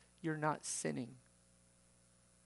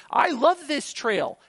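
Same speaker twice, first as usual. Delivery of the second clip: loud, high, drawn-out call; oblivious holler; 9 semitones higher, 15 dB louder, slower than usual. Acted. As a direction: shout it blissfully from off the trail. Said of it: "someone who's thirty yards off the trail".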